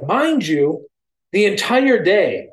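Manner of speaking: high, loud, stretched-out disbelief; comic outraged emphasis; incredulous exclamation. Retold or imitated. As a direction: belt out the absurd detail. Incredulous punchline about cloudy sky.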